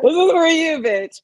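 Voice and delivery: loud, high comic jab; mock insistent retort. Teller mocking the company email to co-host, loud insistence. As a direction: throw it back loudly at a friend.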